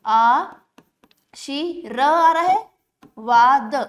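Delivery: आ, शी र, वाद pulled apart slowly; syllable by syllable. Shown as a demonstration. Teacher breaks आशीर्वाद apart for students, slowly. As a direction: break the word into slow pieces. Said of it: "slow motion में थोड़ा उच्चारण करिए".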